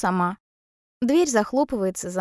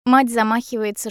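ordinary delivery

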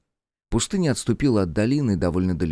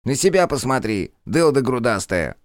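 angry retort; 5 semitones higher, 3 dB louder than usual. Acted. Jester snaps back.

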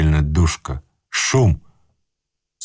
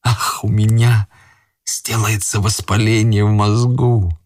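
ordinary delivery

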